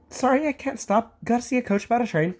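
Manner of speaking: high, fast escape line; hurried. Acted. Thomas fleeing Toby's questions, hurried. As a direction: rush the words and dash off. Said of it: "he went on hurriedly".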